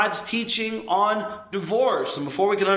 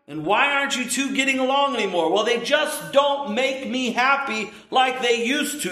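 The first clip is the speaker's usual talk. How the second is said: higher, whining mock voice; mock whiny complaint; 6 semitones higher than usual. Preacher mimics a wife complaining her spouse no longer fulfills her, whiny. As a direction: whine it like a disappointed spouse. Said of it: "And the wife thinks".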